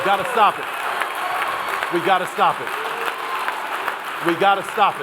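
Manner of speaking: loud, rising plea repeated; forceful shout. Real, not acted. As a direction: hammer it home to the crowd. Urging the audience against colorism.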